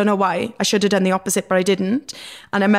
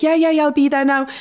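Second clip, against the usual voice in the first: eager brush-off; 7 semitones higher, 5 dB louder than usual. Retold or imitated. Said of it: "I used to be like".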